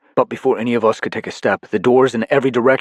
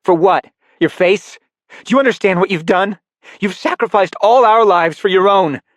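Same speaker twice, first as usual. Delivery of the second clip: much higher and louder, building rage; furious accusation; 12 semitones higher, 7 dB louder than usual. Acted. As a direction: tear into him. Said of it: "none of the warmth".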